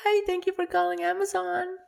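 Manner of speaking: high, slow, sugary call-center lady voice; female call agent. Retold or imitated. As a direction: play the sweet Amazon agent answering the phone. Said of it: "ako babae". (mataas at mabagal na boses ng babaeng ahente)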